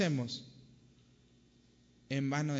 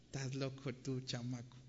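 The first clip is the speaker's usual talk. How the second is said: dismissive mother; 7 dB quieter than usual. Acted.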